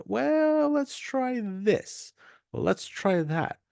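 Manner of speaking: high, drawn-out musing; musing tinkerer. Pretend. Marketer tinkering with the funnel again, high slow musing. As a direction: stretch it out as if trying idea after idea. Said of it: "then you're gonna say".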